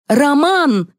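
high, commanding shout of a name; calling out. A narrator's reading. Liza summoning the showman, calling out. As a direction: summon him in a high, sharp call.

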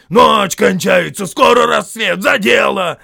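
high, loud troll urging; urgent shouting. A narrator's reading. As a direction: shout them into action. Trolls urge getting to work.

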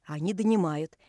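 ordinary delivery, nothing marked

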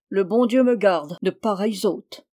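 plain reading voice

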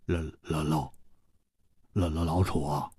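hushed, low, halting stutter; stunned stammer. A narrator's reading. Hu finds his friend motionless, stammering in shock. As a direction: falter on the name, barely getting it out. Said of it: "他十分吃惊， 失声说".

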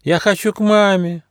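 whining. A narrator's reading. Homesick platypus wanting his mother, whining. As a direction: whimper it like a homesick little one. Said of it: "захныкал утконос".

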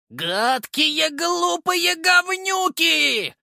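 high, slow, loud stretched yell; shrill creature shout. Acted. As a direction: scream it from inside a jaw. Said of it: "кричала Галя из пасти вожака".

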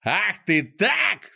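slow, high-pitched outraged yell; high, drawn-out cry of rage; Клопс yelling, choked with anger. A narrator's reading. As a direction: stretch each word in mounting fury. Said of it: "заорал, задыхаясь от гнева".

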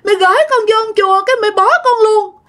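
put-on high, sobbing toddler complaint; crying child voice. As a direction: sulk and cry like a small child left behind. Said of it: "Vừa khóc mà vừa nói nha".

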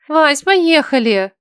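медленно, умоляюще; взмолись, чтобы ушли; взмолилась. Acted. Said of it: "снова взмолилась она". (умоляюще)

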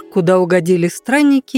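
plain reading voice

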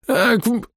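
cut-off yelp. A narrator's reading. Hero hit and knocked out.

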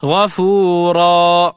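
غفورا read slowly as ghafūrā, the final alif drawn out in recitation tone; pausal reading. Shown as a demonstration. Teacher shows the class how غفورا is read at a pause, slow recitation. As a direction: chant غفورا slowly, ending on a long open a. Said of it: "فتلفظ هكذا".

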